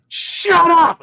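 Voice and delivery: high, loud retold mom yelling; imitating an angry mom's shout. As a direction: yell it at your son to cut him off. Mom snaps during retold argument.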